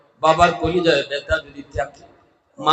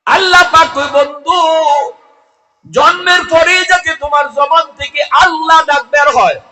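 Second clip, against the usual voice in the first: high, loud chanting sermon delivery; ringing, high-pitched lament to a crowd; impassioned preaching; 11 semitones higher, 8 dB louder than usual. Acted. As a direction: preach at full force, pitched far up. Preaches God's reply at full force.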